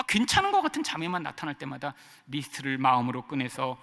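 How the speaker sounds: usual speaking voice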